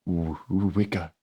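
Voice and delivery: slow, weak, faltering voice of the wounded; wounded Avron calling his brother, weak and slow; shriveled husk of a voice. Acted. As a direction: barely get the name out, stumbling. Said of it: "a shriveled husk of what it once was".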